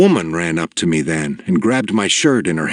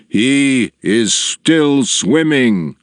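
higher, slow, ringing proclamation; an old leader's triumphant cry; joyful shout; 6 semitones higher, much slower than usual. A narrator's reading. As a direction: announce the good news to the whole crowd. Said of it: "he raised his voice and shouted".

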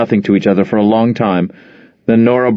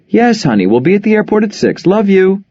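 much higher, bright surprised mother; delighted phone greeting; 10 semitones higher than usual. Acted. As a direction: greet your daughter warmly on the phone.

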